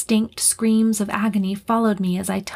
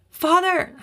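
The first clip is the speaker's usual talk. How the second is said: high desperate scream; 9 semitones higher than usual. Acted. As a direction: cry out for your father in panic. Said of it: "I screamed".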